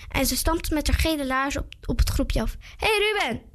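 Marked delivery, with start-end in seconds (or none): 2.8-3.4 high-pitched calling greeting; mildly raised "hey" call; loud greeting. A narrator's reading. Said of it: "zei ze heel hard".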